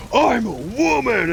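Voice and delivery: high, slow, hammed-up indignant cry; mock freak-out. Mimicking a customer at the counter, mock freak-out. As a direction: imitate someone freaking out, stretching it high. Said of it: "they're freaking out because".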